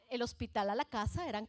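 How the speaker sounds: normal talking voice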